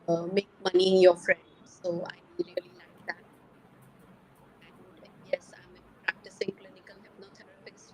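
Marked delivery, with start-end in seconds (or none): 1.7-7.9 faint, far from the mic; phone held too far away; barely audible. Real, not acted. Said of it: "your mobile is far away from you".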